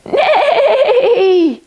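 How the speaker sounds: high neigh with a laugh; whinny and laugh. Pretend. Horse neigh again.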